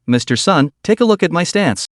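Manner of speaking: usual speaking voice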